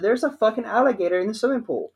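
high, fast repeated blurt; mock panic. Repeating the alligator news to dad, a high, fast urgent blurt.

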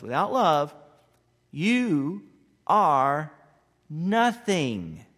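Without love pushed loud, nothing held out; loud, slow quoting of Paul; loud proclamation. Acted. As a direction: proclaim it to the back row. Paul quoted, loudest peak.

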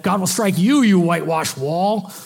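retold outburst, stretching the final words; angry retort. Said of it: "he got mad".

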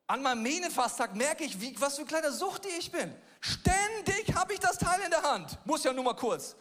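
preacher laughing at his own phone habit; animated. Real, not acted. Preacher confessing his phone addiction.

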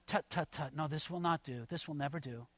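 low, hushed disapproval; quiet, aloof dismissal under the breath; calm detachment. Acted. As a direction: tut softly like an important official. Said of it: "he's listening with a calm detachment".

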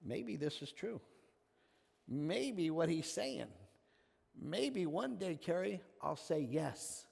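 hushed, slow, wondering to himself; almost a whisper of doubt; quiet inner thought. Retold or imitated. Paul doubting privately at Stephen's stoning, hushed. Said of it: "did reserve in his mind".

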